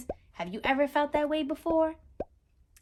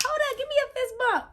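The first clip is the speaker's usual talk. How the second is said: higher, fast and excited; 12 semitones higher, faster than usual. Real, not acted. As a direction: cheer them on, quick and bright.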